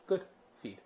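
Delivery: softly spoken, spaced-out cue words; gentle, unhurried praise; calming marker. Shown as a demonstration. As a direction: soothe the dog with a quiet good. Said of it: "good tends to be more calming".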